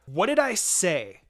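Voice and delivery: slow, loud scolding; angry. A narrator's reading. Sister scolding boys after punching them, slow angry scold. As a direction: drag it out like a cross big sister. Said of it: "I said angrily".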